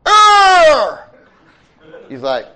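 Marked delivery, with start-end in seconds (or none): 0.1-1.1 a very high, very loud wordless cry; a shout that reaches him only as noise; faint distant shout. A narrator's reading. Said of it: "all he hears is".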